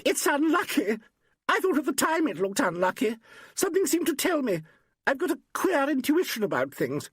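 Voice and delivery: put-on very high, agitated young woman's outcry; high-pitched superstitious alarm; crying out. A narrator's reading. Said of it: "Suddenly Valerie Gaines cried out".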